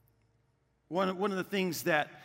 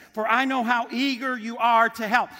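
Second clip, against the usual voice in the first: louder, eager reading of the verse; enthusiastic reading; 4 semitones higher, 7 dB louder than usual. Acted. Pastor reading Paul's words to the church, louder and eager. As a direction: read it like you're eager too.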